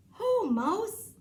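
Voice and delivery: lightly voiced hare; cheery hare. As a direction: hail an old friend as the hare. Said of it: "says the hare".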